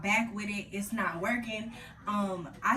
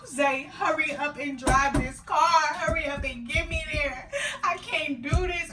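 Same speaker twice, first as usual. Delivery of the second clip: high, loud desperate wail; laughing while mock crying; 7 semitones higher, 7 dB louder than usual. Woman in labor begging her partner to hurry, wailing. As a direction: beg him to drive faster, half laughing. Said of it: "I'm crying to God".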